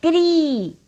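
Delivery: high, long, falling क्री; ri sound in क्री. Shown as a demonstration. Teacher modelling the syllable, long kree for क्री. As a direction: draw out the ee in क्री. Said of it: "ये क्री है".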